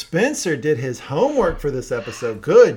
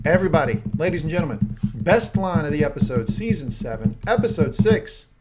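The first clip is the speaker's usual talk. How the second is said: mock-grand announcer, louder and lifted; 5 semitones higher, 4 dB louder than usual. Host announces best line of the episode, showy announcer voice. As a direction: proclaim it in punchy, separate phrases like an awards host.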